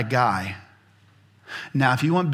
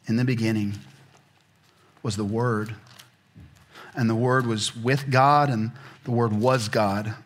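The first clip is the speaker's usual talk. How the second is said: slow, lower, solemn reading; scripture reading; 4 semitones lower than usual. Acted. Preacher reading the Gospel of John to the congregation, solemn. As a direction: let each phrase land, reverent and slow.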